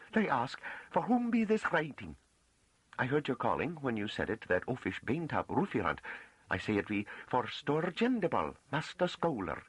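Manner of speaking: high-pitched rustic woman recounting events; earnest farm woman. A narrator's reading. Farm woman reports to the master how she got in, earnest. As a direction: tell it eagerly in a high country voice.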